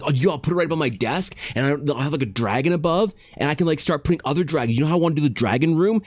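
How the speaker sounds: a fan plans his dragon room, fast and excited; excited fan gushing. Retold or imitated. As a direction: rattle it off like an eager fan.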